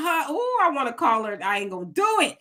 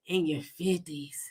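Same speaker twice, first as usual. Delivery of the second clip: whisper; 8 semitones lower, 9 dB quieter, slower than usual. Real, not acted. Mocking aside, whispered.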